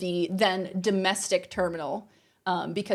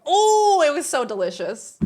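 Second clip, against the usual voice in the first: long, high, loud "oh" of delight; gleeful high-pitched wail; delighted relish; 4 semitones higher, 4 dB louder than usual. Real, not acted. Relishing the man's humiliation.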